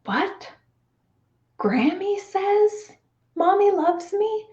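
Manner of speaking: high, slow, stunned question; realizing it with a jolt; shocked disbelief. Retold or imitated. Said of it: "I was like".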